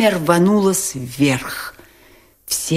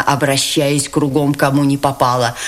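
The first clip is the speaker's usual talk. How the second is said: ordinary delivery, nothing marked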